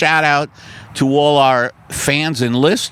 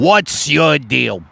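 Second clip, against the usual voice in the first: mock angry; 2 semitones higher, much slower than usual. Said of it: "And I slam the window".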